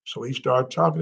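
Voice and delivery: normal talking voice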